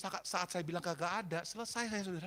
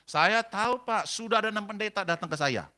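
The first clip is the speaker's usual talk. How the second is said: loud, acted hostile outburst; snapping at him; 3 semitones higher, 8 dB louder than usual. Husband to the seventh pastor to visit, barking. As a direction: imitate a husband telling off an unwanted visitor. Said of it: "Karena dibentak saya".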